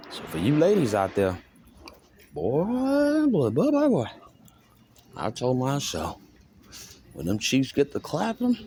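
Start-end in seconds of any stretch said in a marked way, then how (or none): 2.3-4.1 put-on high, drawn-out boy boy boy; exclaiming boy. Man exclaiming to ladies watching, high and drawn out. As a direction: call it out way up high.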